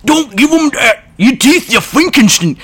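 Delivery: high, loud gravelly orc shout; orc yell. Shown as a demonstration. Reader previewing his orc voice, loud orc yell. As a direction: bellow like a mad orc. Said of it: "you're gonna get the joy of hearing me yell stuff like".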